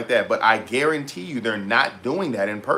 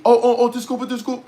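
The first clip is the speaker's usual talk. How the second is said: much higher mock-apologetic voice; mimicking flustered woman; 12 semitones higher, 4 dB louder than usual. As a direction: imitate her hurried, high-pitched excuse.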